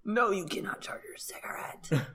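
hushed scolding whisper. Pretend. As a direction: whisper it like a private insult. Mock scolding friend.

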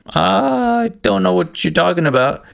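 high, slow incredulous retort; hammed-up baffled reply; mock disbelief. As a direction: play dumb, way up high. Retold confrontation with the supervisor.

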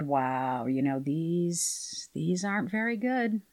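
soft, slow, low inner voice murmuring. Retold or imitated. Her inner voice judging the first takes, murmured softly. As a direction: let the doubt slip out quietly. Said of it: "a voice inside my head said".

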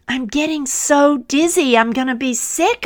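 high, loud, fast complaint; screaming. Acted. Clay on the spinning wheel begging, high and loud. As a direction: cry out dizzy and panicked. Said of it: "I screamed".

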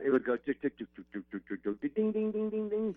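ticking then ringing dings; weather wire sound effect. Retold or imitated. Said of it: "You do that quite well, [name]".

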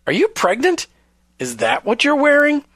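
high, tactless rising question; mock incredulous husband. Host voicing questions husbands should never ask wives, mock incredulous. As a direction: let the pitch leap up in staged disbelief.

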